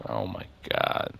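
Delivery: low, slow, dismayed murmur; acted dismay, almost under the breath; let down. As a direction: sink into a hushed, drawn-out groan of disappointment. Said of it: "And then what a letdown".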